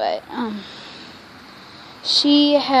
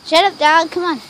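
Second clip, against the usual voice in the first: ordinary talk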